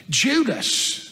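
name pitched high, then falling away; incredulous repeat. A narrator's reading. Peter repeating the betrayer's name, incredulous repeat. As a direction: exclaim the name with a high swoop.

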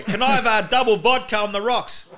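high, loud drink order; bellowed order at the bar; yelling out. Acted. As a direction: shout it out over a noisy bar. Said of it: "we'd go to bars and yell out".

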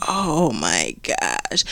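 quiet slow gasp. Retold or imitated. Teacher reacting to the kid.

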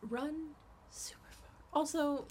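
hushed whispered warning. Acted. Warning listeners off creepy partners, whispered. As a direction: whisper it like a secret.

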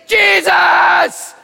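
desperate high-pitched shout for attention; loud shouting. Acted. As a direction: cry out at the top of your voice, drawn out. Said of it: "he was so loud".

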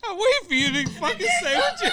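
laughing mid-sentence; words choked by laughter. Real, not acted. Both laughing hard.

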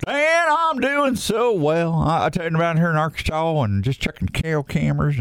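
hammed-up Southern impression of a folksy outdoorsman; Philip Vanderpool. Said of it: "I did a podcast as Philip Vanderpool".

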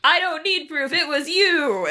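high-pitched, insistent retort; hammed-up indignant quote; mock accusation. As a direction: snap back like a soap-opera daughter. Acted quarrel between Veronica and Hiram.

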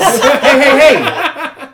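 laughing shout. Real, not acted. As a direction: crack up and holler. Player shouts at joke.

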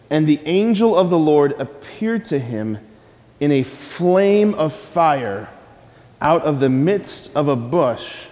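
measured, solemn reading of scripture. Acted. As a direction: read the passage with weight. Reads scripture aloud.

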